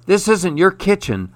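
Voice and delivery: usual speaking voice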